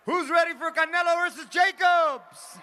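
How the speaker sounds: big crowd-hyping shout; hype shout. Real, not acted. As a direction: fire up the crowd, loud and high. Host hypes the crowd.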